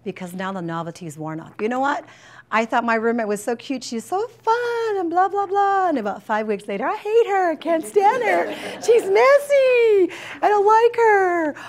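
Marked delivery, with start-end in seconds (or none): 2.5-5.9 imitating a student. Imitating a student about roommate. 6.8-11.5 high, loud whiny student with a laugh; mock student complaining. Counselor mimicking a student ranting about her roommate, high whiny voice.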